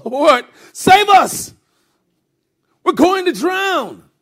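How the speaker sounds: high, loud drawn-out shout; acted desperate yell, stretched out; panicked cry for help. A narrator's reading. Disciples waking Jesus in the storm, panicked cry.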